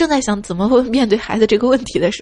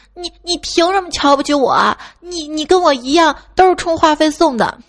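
imitating a squabbling boy, higher voice; mock quarrel; 6 semitones higher, 3 dB louder than usual. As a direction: argue like an indignant little boy. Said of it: "听到两个男孩在吵架啊". (模仿小男孩吵架)

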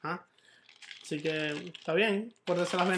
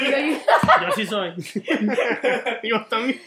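loud, higher, through real laughter; laughing; 3 semitones higher, 5 dB louder, much slower than usual. Real, not acted. Friend joining in the joke, laughing. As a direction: crack up while owning up to it. Joking along after a jibe.

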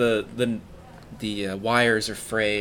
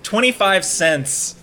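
high, slow explaining; 9 semitones higher, 5 dB louder, slower than usual. Acted. Explaining two bits, mock.

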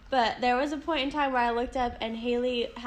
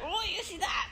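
higher, soft mimicked remark; hushed aside; 5 semitones higher, 9 dB quieter than usual. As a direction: say it softly, as if discreetly pointing. Mimics covert aside during the quarrel.

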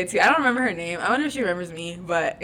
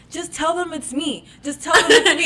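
put-on drunk girl quote; 7 semitones higher, 6 dB louder than usual. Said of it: "she was like".

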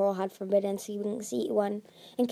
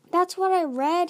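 higher, louder defensive reply; protesting; 8 semitones higher, 5 dB louder than usual. A narrator's reading. Starflight defending himself to Tsunami, protesting. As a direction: protest your innocence. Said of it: "he protested".

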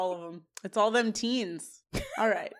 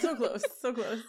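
laughing. Real, not acted. Laughing echo.

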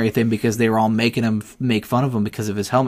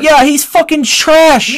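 heated; 13 semitones higher, 6 dB louder, slower than usual. Real, not acted. Heated argument over quarterback.

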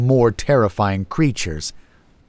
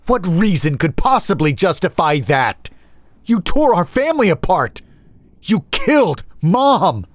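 high, heated outburst climbing higher; enraged accusation; 9 semitones higher, 4 dB louder than usual. Acted. Younger brother confronting the older over their mother, enraged. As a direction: demand and accuse at full pitch.

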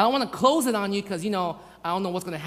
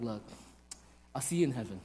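low, soft, sincere goodbye; quiet, regretful affection; tender farewell; 9 semitones lower, 9 dB quieter, faster than usual. Acted. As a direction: say it gently, like a last goodbye. Imagined tender farewell to congregation.

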